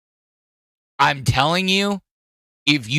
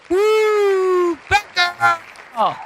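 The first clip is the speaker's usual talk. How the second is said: excited cheer; 13 semitones higher, much slower than usual. Real, not acted. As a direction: hype up the name like a fan. Cheering a gift recipient.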